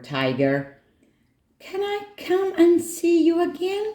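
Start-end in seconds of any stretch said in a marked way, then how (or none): 1.6-3.9 put-on high-pitched child's question; high, pleading little request; hopeful child. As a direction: ask hopefully in a little-girl voice. Nora asks the tiger hopefully.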